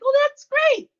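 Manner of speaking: retelling her cheery reply before reading on; mock delight. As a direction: imitate your own over-eager first reaction. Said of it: "I'm like".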